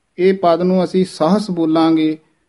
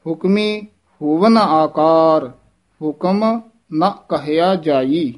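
breaks after Hukmi and ਹੁਕਮ; ਹੁਕਮ, pause, measured phrase; pause after word; slower than usual. Shown as a demonstration. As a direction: set Hukmi apart, then recite slowly. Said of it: "ਹੁਕਮੀ ਪਦ ਤੇ ਯਮਕ ਲਾ ਕੇ".

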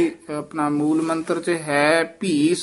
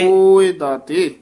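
louder slower model; 2 semitones higher, 6 dB louder, slower than usual. Shown as a demonstration. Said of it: "teacher models line".

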